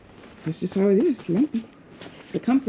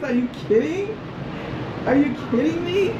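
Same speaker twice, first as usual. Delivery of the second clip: raised pitch, rising incredulous questions; amazed disbelief; 7 semitones higher than usual. Real, not acted. Traveler stunned by the view from her room, amazed. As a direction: lift each question upward in amazement.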